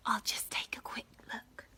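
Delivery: whispered, sneaking; sneaky whisper. Acted. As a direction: whisper like you shouldn't be here. Sneaking past forbidden door.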